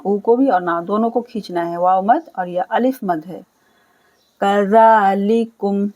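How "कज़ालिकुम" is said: slow recited word; Quran recitation. Shown as a demonstration. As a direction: chant the word slowly. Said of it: "नरमी के साथ और खींचेंगे".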